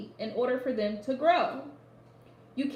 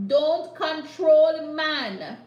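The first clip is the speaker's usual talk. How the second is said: "control" stretched long and louder, rising; emphatic slow stress; 3 semitones higher, 7 dB louder, much slower than usual. Real, not acted. Psychic telling the client she can't control men, slow and stressed. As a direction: drag out "don't control man" slowly, leaning hard on "control".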